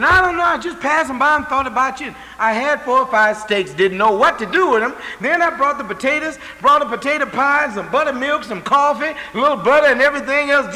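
high, loud rush of gifts; excited neighbour. Retold or imitated. Neighbour bringing food, acted.

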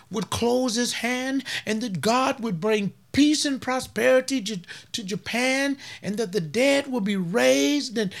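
mimicking a smooth prosperity preacher to the congregation; mocking other preachers. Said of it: "They're gonna say".

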